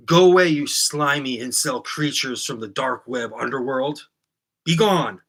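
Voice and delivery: slow, loud, contemptuous banishing; vitriolic send-off. Shown as a demonstration. Host banishing incel trolls in a loud slow sneer. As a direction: cast the trolls out like a sorcerer. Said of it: "more vitriol".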